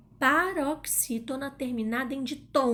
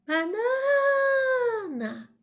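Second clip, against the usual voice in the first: high drawn-out call, stretching the stressed NA; long singing holler on a high held vowel; calling the word; 12 semitones higher, much slower than usual. Shown as a demonstration. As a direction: call it out like someone far away. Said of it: "A gente vai chamar a palavra".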